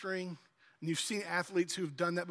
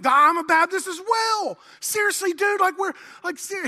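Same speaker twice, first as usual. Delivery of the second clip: very high, loud shout of delight; over-the-top excitement; 11 semitones higher, 10 dB louder than usual. Acted. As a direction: shout it ecstatic, pitched way up. Acted joke, mounting excitement.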